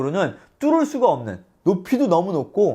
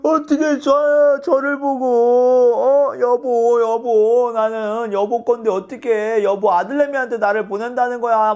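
높은 목소리로 징징대며 떼쓰기; 남편에게 매달려 우는 척; 5 semitones higher than usual. Retold or imitated. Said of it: "초선이 폭풍 눈물". (높고 서럽게 우는 흉내)

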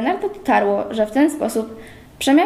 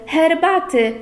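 higher, more urgent herbaty, falling at the end; eager; 6 semitones higher, slower than usual. A narrator's reading. Lucek fussing over the fainted girl, more eager herbaty. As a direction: press the word herbaty harder, brighter. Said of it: "zakrzątnął się Lucek gorliwie".

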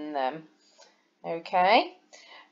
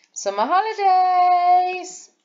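much higher, louder, slow and drawn out; excited; 12 semitones higher, 5 dB louder, slower than usual. Shown as a demonstration. Teacher shows excitement, high and stretched. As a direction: sing it out with joy. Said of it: "my voice and the exclamation mark make them sound exciting".

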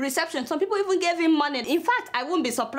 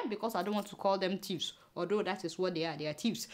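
much softer, lower, as if not meant to be heard; conspiratorial aside; 8 semitones lower, 10 dB quieter than usual. Acted. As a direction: drop the voice to let slip the secret. Aside calling officials thieves.

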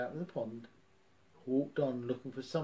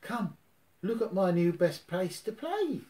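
out of breath after a fall; panting a little; breathless; 6 semitones higher, 3 dB louder than usual. A narrator's reading. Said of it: "Piglet said breathlessly".

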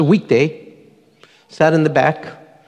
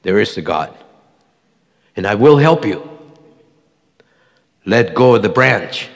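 lower, calm voice from above; voice of God; 6 semitones lower than usual. Retold or imitated. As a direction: speak as God answering. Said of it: "Then he heard a voice".

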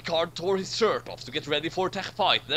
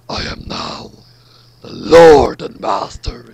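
whisper, then roar the title slowly; booming villain; 4 semitones lower, 17 dB louder, slower than usual. Acted. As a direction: whisper then slow thunderous villain boom. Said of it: "it said".

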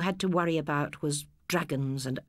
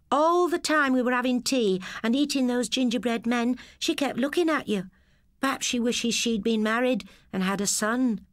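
lightly voiced girl; 7 semitones higher than usual. Girl voices her thought.

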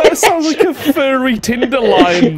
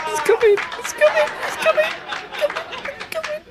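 getting higher and softer each time; more excited but hushed each repeat; rising anticipation; 7 dB quieter, faster than usual. Real, not acted. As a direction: build up tension quietly. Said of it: "I'm just waiting for it".

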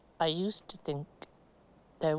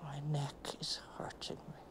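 breathy whisper, much softer; hushed complaint of a sore neck; 2 semitones lower, 10 dB quieter, faster than usual. Real, not acted. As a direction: whisper through the pain. Complaining of pain, softly.

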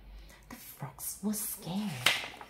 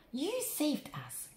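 shouting; 10 semitones higher, slower than usual. A narrator's reading. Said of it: "shouted the frogs".

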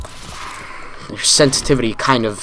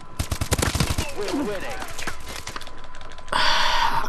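long, high, breathy sigh of frustration; weary fed-up sigh; frustrated sigh; 9 dB quieter than usual. Real, not acted. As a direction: sigh out the defeat. Frustrated gamer after dying.

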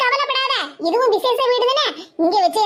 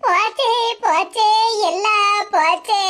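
slow, drawn-out wail of loss; lament; slower than usual. Acted. Lamenting that everything is lost, slow wail. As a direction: stretch each word mournfully.

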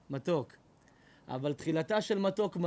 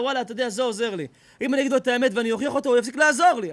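fast excited scheming; 5 semitones higher, 10 dB louder, faster than usual. Retold or imitated. Flatterer's inner thought, fast.

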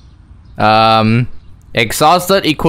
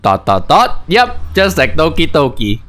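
ordinary talk

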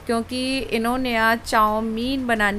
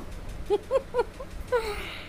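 high laughing fit, softer; real laughter; 12 semitones higher, 10 dB quieter than usual. Real, not acted. Laughing at husband stirring the chowmein, real giggles. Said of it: "मुझे तो बस हंसी आ रही थी".

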